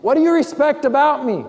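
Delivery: mock yell. Lazy husband yells to his wife from the chair, mock shout. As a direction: shout it across the room at her. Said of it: "yell at you".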